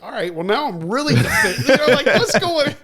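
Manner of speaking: laughing retelling. Retold or imitated. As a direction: retell your alarm while cracking up. Retells his reaction amid laughter.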